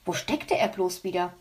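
ordinary delivery

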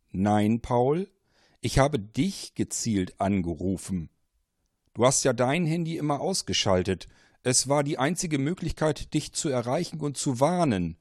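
mysterious voice, pressing hard; insistent caller. Acted. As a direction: lean in, make him listen. Caller explains the call.

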